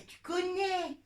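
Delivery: ordinary delivery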